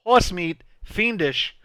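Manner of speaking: halting movie-star impression, each phrase peaking high then falling; Christopher Walken. Quoting a dazed exterminator on a stretcher, Walken impression. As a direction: break it into separate, punched phrases. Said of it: "that's one of my walking lines".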